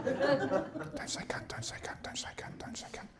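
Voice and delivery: whispered pleading, fading to nothing. Acted. Dad at the campfire, fading whispered prayer. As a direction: pray under your breath, dying away. Dad praying kid won't swear.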